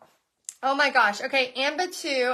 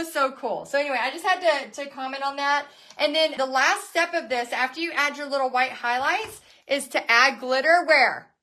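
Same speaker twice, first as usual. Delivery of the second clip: normal talking voice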